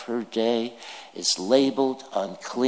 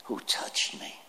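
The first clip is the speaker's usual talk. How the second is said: a near-whispered honest question; whispered, genuinely asking who touched him; honest, hushed question; 7 dB quieter than usual. Shown as a demonstration. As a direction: ask it softly and sincerely, nothing hidden. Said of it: "a straightforward question, an honest question".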